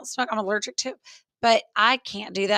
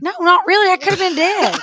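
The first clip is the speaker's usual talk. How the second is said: excited protest; 10 semitones higher, 9 dB louder than usual. Real, not acted. Joking about nearly being killed.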